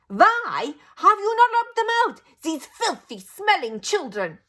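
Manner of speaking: high, loud foreign witch voice, slowing to a rage; Grand High Witch raging at the witches about children; enraged witch. A narrator's reading. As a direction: build from complaint to fury. Grand High Witch complains furiously.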